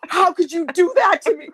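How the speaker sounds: high, wounded mock accusation; mortified reproach. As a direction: imitate an embarrassed sister's reproach. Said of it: "She was all embarrassed".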